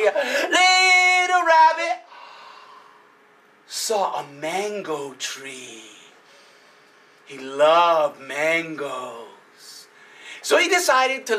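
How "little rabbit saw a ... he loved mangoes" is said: slow hush of a bedtime tale; slow storytelling. A narrator's reading. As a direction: lull them with a slow, sinking line. Story slows, drops down.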